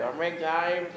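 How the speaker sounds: a quick nod to [name]'s voice; [name] impression. Telling hosts Old Greg's origin, a light [name] imitation. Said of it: "he does talk a little bit like [name]".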